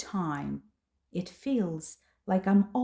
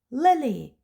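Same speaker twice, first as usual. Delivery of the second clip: sharp, loud, higher cry of her name; scream; 5 semitones higher, 7 dB louder than usual. Acted. Mother scolding her daughter, loud shout. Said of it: "I scream".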